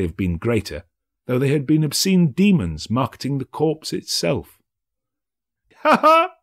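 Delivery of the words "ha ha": very high, loud mocking laugh; gleeful crone's cackle; harsh cackle. A narrator's reading. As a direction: cackle over the dead man's money. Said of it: "laughed the same woman".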